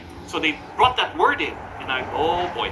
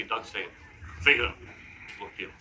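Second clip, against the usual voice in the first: quiet coaxing; 6 semitones lower, 6 dB quieter than usual. Retold or imitated. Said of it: "and they say".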